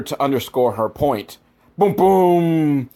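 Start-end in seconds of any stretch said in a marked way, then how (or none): 1.8-2.9 mock music stings. Imitating dramatic score hits.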